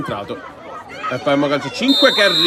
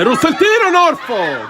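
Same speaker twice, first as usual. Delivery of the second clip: excited shot call; 8 semitones higher, 4 dB louder than usual. Real, not acted. Shot and save.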